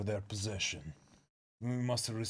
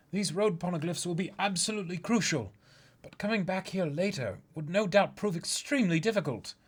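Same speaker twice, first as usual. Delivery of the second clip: much higher, earnest and urgent strategist; a higher, eager voice laying out a risky plan; higher character voice; 7 semitones higher, 4 dB louder than usual. Acted. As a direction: pitch up and plead the plan with urgency. Reads a second manga character.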